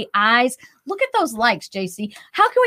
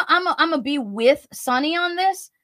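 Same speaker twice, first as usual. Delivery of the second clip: ordinary delivery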